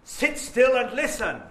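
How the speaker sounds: every word hammered loud and high; stern drilled classroom command; deliberate stress. Shown as a demonstration. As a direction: bark each word out like a stern teacher. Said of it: "And I can stress them deliberately".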